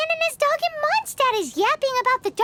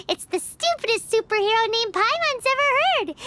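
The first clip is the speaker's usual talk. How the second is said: usual speaking voice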